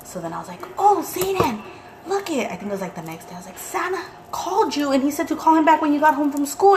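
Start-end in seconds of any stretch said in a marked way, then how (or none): 0.8-2.5 excited mom. Retold or imitated. Mom excitedly showing son Facebook. 3.6-6.8 excited news. Retold or imitated. Mom telling son Santa called.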